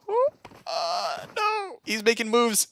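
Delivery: high-pitched squealed reaction; excited alarm. Real, not acted. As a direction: squeal in suspense, voice leaping up. Tense moment, nearly spotted enemy.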